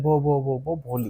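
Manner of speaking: halting, soft stammer on the name; a shy girl finally says her name, halting and quiet; stammering quietly. Acted. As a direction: push the name out through a stammer, softly. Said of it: "तो वो finally बोलती है".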